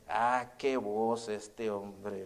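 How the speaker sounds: low, soft, very slow mock lament; drawn-out dismay. Landlord despairs of tenant leaving to serve God, drawn-out dismay. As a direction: stretch the "Ah" and "qué" in disbelief. Landlord dismissing the departing preacher.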